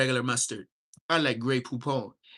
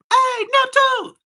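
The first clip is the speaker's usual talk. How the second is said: high, loud, slow yell across the yard; long high shout of a name; calling out; 11 dB louder, slower than usual. Retold or imitated. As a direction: hail someone from far off.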